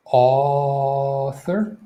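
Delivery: author with the first vowel stretched right out; very slow, held author while demonstrating; long AW. Shown as a demonstration. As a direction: draw out the aw in author. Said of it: "this is that sound where".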